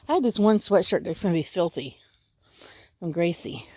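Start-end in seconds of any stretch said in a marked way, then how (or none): none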